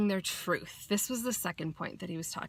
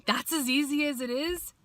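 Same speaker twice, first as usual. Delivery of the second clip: amazed disbelief; 7 semitones higher than usual. Retold or imitated. Disbelief continues.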